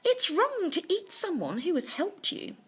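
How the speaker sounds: a lightly voiced boulder spirit. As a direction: answer quickly and firmly. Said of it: "quickly replied".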